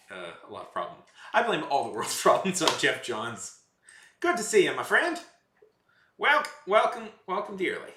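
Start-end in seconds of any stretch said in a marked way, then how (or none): 4.2-7.9 higher, louder friendly Southern welcome; folksy Southern greeting. Pretend. Streamer welcomes a viewer in hammed-up Southern accent. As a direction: lay on the Southern charm. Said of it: "I have this accent I've adopted".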